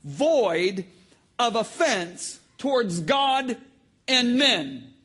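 loud, high preaching delivery; full-force preaching. Real, not acted. Preacher quoting Paul to the congregation, full-force preaching. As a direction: drive the scripture home with force.

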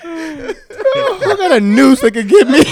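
high, slow, laughing through the words. Real, not acted. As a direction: squeal it out while cracking up. Joking amid group laughter.

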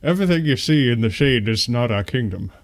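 lightly voiced Scottish Connery accent; a hint of Connery playing Mufasa. Host reciting Mufasa's line as Connery, mild Scottish accent.

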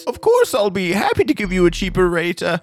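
high, fussy cabbie voice; obliging cab driver. Acted. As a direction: gush as an obliging driver, pitched well up. Cab driver persuaded by birthday plea.

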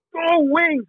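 host yelling back to the caller; mock yell. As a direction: answer the cheer. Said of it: "I would yell".

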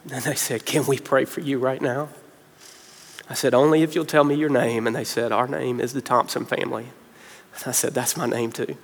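very soft and low, barely getting it out; choked with emotion. Real, not acted. Preacher recalling strangers offering prayer in hospital, choked with emotion. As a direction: tell it hushed, close to tears. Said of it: "about to get emotional now".

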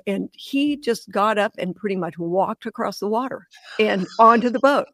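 ordinary talk

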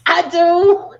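loud, high outburst; gleeful admission. Real, not acted. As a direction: blurt it out proudly before cracking up. Gleeful admission before laughing.

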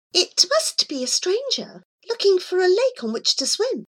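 high, light voice of the cat. Acted. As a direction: voice the cat Miss Scurry high and airy. Cat speaks.

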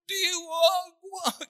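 high, crying no, no; begging through sobs; wife crying as she pleads; sobbing plea. Acted. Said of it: "Hindi niya kaya yun".

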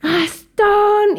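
high, drawn-out name call; mock scolding call. Calling out to runaway friend. (llamado de regaño, agudo y alargado)

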